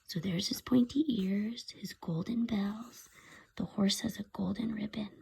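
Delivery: hushed storytime aside; whispered aside. Real, not acted. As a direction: lean in and whisper.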